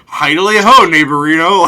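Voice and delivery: high, loud chirpy neighborly greeting; Ned Flanders greeting. Retold or imitated. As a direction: hail the monster like a cheerful churchgoing neighbor. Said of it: "Ned Flanders ass dude".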